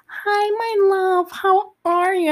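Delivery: high, slow, syrupy mock sweetness; mocking sugary mom. Mimicking mom cooing to the uncle, mocking and high. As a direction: coo it like a fake-sweet mother on the phone. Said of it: "and she goes".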